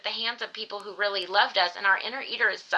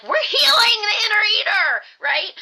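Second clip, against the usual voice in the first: much higher and louder, proud cheer; triumphant exclamation; 12 semitones higher, 8 dB louder than usual. Retold or imitated. Wife cheering as she hands husband cookies, loud and high. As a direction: announce it like a victory.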